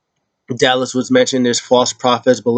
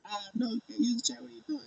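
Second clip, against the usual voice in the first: mock referee scolding; 12 semitones higher, 19 dB quieter, much faster than usual. Ref voiced scolding.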